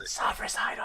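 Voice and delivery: whispered mock prayer; hushed prayer. Game master redoing the prayer after "don't yell", whispering. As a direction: whisper the call to a god.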